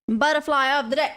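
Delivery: segment announcer. Pretend. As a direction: announce it like a jingle tagline. Announces her daily segment title.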